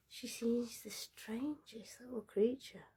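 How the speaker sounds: slow, hushed whisper, drawing it out; slow suspenseful whisper. A narrator's reading. Narrator slowly whispering the creature's reveal to listeners. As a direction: whisper it like a secret creeping in.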